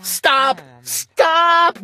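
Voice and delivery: high rising double Stop; little sister screaming at the glunk; screamed. A narrator's reading. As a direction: shriek it twice, building. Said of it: "my little sister screamed".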